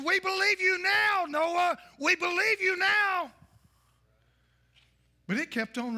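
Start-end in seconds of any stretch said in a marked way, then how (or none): 0.0-1.8 higher, louder desperate plea; pleading townsfolk. Retold or imitated. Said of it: "and they said". 2.0-3.3 higher, louder, slowed plea; slower pleading. Retold or imitated. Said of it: "and they said".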